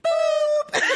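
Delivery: very high squeaky sound effect; squeaky boop. Retold or imitated. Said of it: "I said to the T V".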